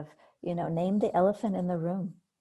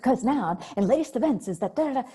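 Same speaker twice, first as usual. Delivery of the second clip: urgent newscaster; 6 semitones higher, 6 dB louder than usual. Retold or imitated. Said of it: "they're not talking calmly to you".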